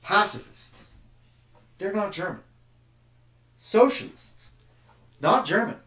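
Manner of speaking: a jeering patriot listing the traitors; nationalist scorn. Acted. Voices nationalist dismissing opponents.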